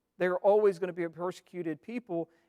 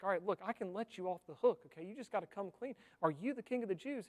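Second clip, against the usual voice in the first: softer, hushed coaxing offer; Pilate bargaining; 7 dB quieter, faster than usual. Acted. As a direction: lean in and quietly offer a way out. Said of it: "Pilate's like".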